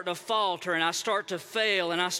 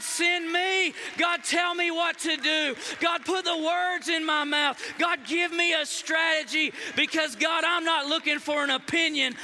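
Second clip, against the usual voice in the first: shouted prayer; 6 semitones higher than usual. Acted. People in a prayer closet calling on God, shouting. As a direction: plead with everything in you. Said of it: "going to a prayer closet saying".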